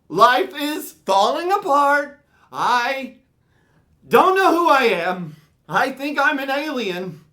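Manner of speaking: high, loud mock wailing; panicked child. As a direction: cry out in hammed-up existential panic. Panicked child wailing while running.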